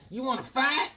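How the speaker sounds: high, taunting dare; bully challenge. Retold or imitated. The hoods dare the boy to fight in a high, taunting voice. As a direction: pick a fight.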